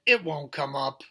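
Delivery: brother shouting it loudly. Acted. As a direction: shout it at the little boy. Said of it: "His brother said".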